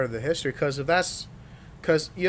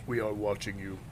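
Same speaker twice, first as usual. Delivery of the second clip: disguised deep voice; 4 semitones lower, 7 dB quieter than usual. Pretend. Creepy joke to listeners.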